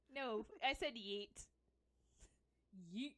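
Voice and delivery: yeet said slowly and loudly; stress on yeet. Shown as a demonstration. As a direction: lean on yeet to clear it up. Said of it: "Did you say yeet or eat?".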